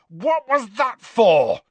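slow, high, outraged bellow. A narrator's reading. Harry demands why his friend hit him, bellowing. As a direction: bellow each word in outrage. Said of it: "Harry bellowed".

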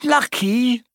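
high-pitched incredulous shriek; indignant shriek. A narrator's reading. Hermione to Ron about the plant, shrieking. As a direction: shriek it back in disbelief. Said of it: "shrieked Hermione".

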